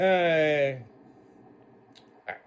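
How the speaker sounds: ordinary talk, nothing marked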